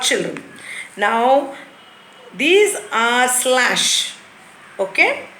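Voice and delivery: ordinary talk, nothing marked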